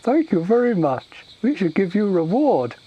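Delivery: high, loud grateful ogre voice; simple-minded ogre, grateful. Acted. Ogre thanking the orc for the warning, grateful. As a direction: gush thanks like a dim ogre.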